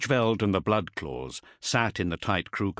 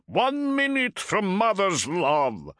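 high, slow exultant shout; 11 semitones higher, slower than usual. A narrator's reading. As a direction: bellow it with battle joy. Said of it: "shouted Bringar".

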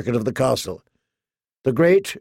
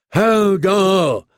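high straining grunt of the name; effortful cry; 5 semitones higher, 3 dB louder than usual. A narrator's reading. Hodor shoving at the heavy door, effortful cry. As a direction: heave it out on a long, high note.

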